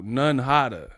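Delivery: ordinary talk, nothing marked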